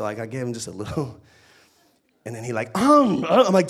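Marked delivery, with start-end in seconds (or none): 2.8-3.4 loud, high "um"; imitated baby food grunts. Baby begging dad for chocolate, imitated food grunts. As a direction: mimic a baby demanding a bite. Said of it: "he does this thing when he wants to eat".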